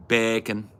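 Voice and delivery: lightly put-on Scouse accent; Scouser. Imitating a Scouser saying bacon, in Scouse. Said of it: "Hearing a Scouser say bacon".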